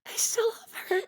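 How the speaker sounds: high, breathy, tearful whisper; crying. Acted. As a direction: choke out the words through tears. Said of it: "I can't stop crying".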